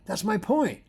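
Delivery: high, urgent no no; teacher correcting a student eagerly; eager correction. Retold or imitated. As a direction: insist excitedly. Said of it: "And I said".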